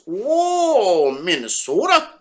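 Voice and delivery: high, drawn-out Minnesota accent on the Oh; a quick mock Minnesota accent; rising, sing-song Minnesota accent. Shown as a demonstration. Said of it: "I would have to add accents".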